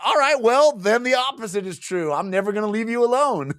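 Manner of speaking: high, hammed-up vow; mock defiant threat. Retelling her threat to the man rejecting her, mock defiant. Acted comic threat to lover.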